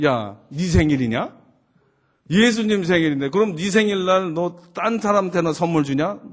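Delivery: 크게 힘주어 따지는 아빠 흉내; 딸의 말을 논리로 되받아치듯; 훈계하는 아빠. Retold or imitated. (으름장 놓는 말투)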